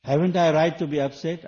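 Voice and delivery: loud, high, aggrieved protest; indignant complaint. Retold or imitated. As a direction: complain like someone who feels wronged. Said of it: "People even say that".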